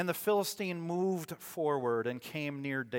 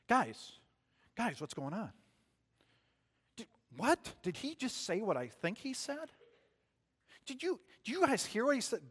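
a little brother pestering, pitched up; eager little brother; 6 semitones higher, 3 dB quieter than usual. Acted. Young David pestering his older brothers, eager and high. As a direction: tug at your brothers' sleeves, pitched up.

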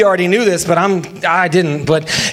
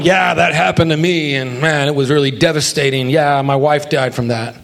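mock gloomy friend; 7 semitones lower than usual. Mocking commiserating friend.

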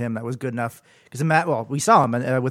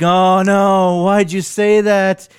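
slow, loud groaning protest; loud dismay; 5 semitones higher, 8 dB louder, much slower than usual. Retold or imitated. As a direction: drag it out in horror. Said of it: "And we're like".

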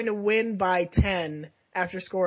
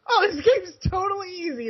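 mock cocky; 11 semitones higher than usual. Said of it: "and you were like".

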